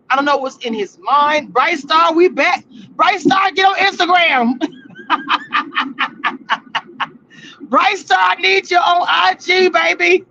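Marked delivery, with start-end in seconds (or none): 1.5-10.2 hyped shout to a chat member; calling out. Real, not acted. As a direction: shout across to a friend, playful. Calling out to a viewer.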